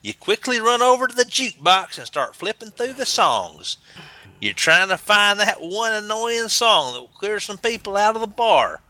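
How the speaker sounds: high, swooping Southern US storyteller; Southern American accent. Acted. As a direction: spin a yarn in an Alabama accent. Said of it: "Southern American accent".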